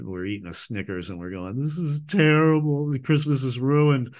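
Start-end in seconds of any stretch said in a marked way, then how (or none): 1.5-4.1 hammed-up wail, a bit higher; mock-devastated complaint; mock crying. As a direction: playfully bemoan a ruined holiday. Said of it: "we cried".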